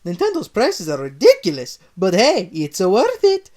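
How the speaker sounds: Mario at the till, loud Italian voice. Acted. Mario about prices.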